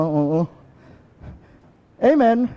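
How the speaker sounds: ordinary talk, nothing marked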